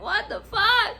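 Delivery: high-pitched, incredulous retort; exasperated disbelief. Retold or imitated. Clerk reacting to customers losing it on her, incredulous. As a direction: act out being thrown by an outburst.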